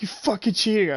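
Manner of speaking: high, loud mock outrage; imitating an angry hate-mail sender; angry accusation. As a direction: yell it at the player who got lucky. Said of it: "He was like".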